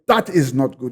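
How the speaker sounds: usual speaking voice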